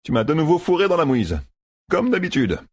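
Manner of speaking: high-pitched exasperated complaint; Matt grumbling. A narrator's reading. Matt blames Rand for the mess, grumbling. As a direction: gripe at a friend, high and fed up. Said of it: "ronchonna Matt".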